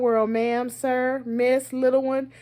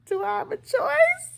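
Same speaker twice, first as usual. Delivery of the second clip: high, laughing question with rising end; cheeky high-pitched query; mock questioning child; 8 semitones higher than usual. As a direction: play a kid asking, half laughing. Child's cheeky reply to parent.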